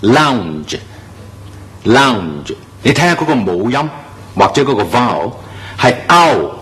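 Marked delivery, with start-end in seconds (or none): 0.0-2.5 lounge, slow, with an ow vowel; slow careful demo of lounge; correct pronunciation. Shown as a demonstration. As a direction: draw out lounge slowly. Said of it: "第三個字啦".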